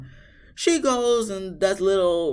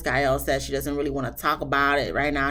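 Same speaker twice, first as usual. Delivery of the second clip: ordinary talk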